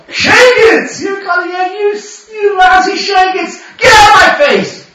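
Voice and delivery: high, loud mock tirade; hammed-up high-pitched scolding; frustrated cursing. Shown as a demonstration. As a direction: curse out your own evil urge. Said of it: "If you ever feel frustrated".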